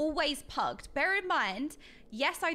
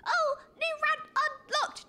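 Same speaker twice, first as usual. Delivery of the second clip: high, slow game-announcer voice; mock achievement announcer. She jokes her rant is an unlocked achievement, mock announcer.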